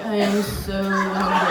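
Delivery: low, slow, spellbound drone; mock craving. Stoned woman gazing at the banana, mock craving. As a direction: intone it low and slow, as if hypnotized.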